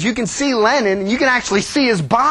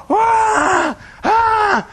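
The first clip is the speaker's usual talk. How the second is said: high fake crying shouts; hammed-up child wail; mock wailing; 13 semitones higher than usual. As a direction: kids hamming up a mourning wail.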